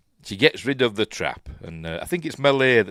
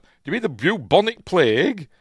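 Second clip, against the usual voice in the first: higher, louder retold query; incredulous question; 10 semitones higher, 9 dB louder than usual. Asking the doctor about bubonic plague, incredulous. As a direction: act out a startled question, pitched up.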